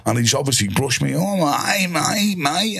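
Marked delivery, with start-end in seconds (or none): 1.2-2.8 higher, light Cockney imitation; Cockney fella. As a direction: chirp mate mate like a Cockney stranger. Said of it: "Cockney fella come over and he went".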